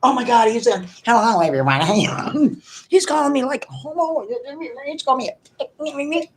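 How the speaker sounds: high, mocking whiny complaint; whiny buddy. Imitating his buddy whining about being called out, high and whiny. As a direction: mimic a petulant friend. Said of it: "buddy quoted, mock whiny".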